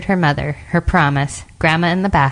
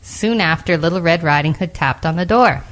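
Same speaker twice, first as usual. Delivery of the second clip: ordinary delivery, nothing marked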